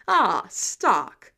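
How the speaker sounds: big loud hearty hail; booming greeting. A narrator's reading. Thor loudly greeting Tony Stark. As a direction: call out to an old friend.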